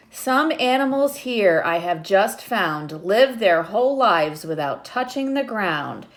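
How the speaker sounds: lightly lilting read-aloud; reading a picture book to children. Acted. Teacher reading the book to her class, lightly lilting.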